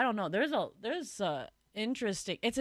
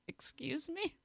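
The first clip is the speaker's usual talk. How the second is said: small, high squeak of disbelief; retold incredulous squeak, much softer; 8 semitones higher, 12 dB quieter than usual. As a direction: softly squeal it in mock disbelief. Retold incredulous reaction through laughter.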